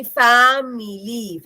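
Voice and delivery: "ഫാമിലി" stretched slowly into fa-mi-lee; high, slow "ഫാമിലി", every syllable held; drawn-out syllables. Shown as a demonstration. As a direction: draw out each vowel of "ഫാമിലി". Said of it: "ഫാമിലിയിൽ മൂന്നെണ്ണം ഉണ്ട്".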